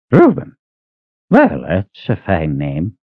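put-on a touch of old Reuben. Reuben tries name.

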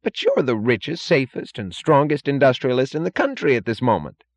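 incredulous, high-pitched protest; Holloway. A narrator's reading. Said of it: "said Holloway incredulously".

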